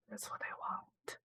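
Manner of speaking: soft whispered aside. Real, not acted. Streamer whispers a conspiratorial aside to chat. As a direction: whisper it like a secret.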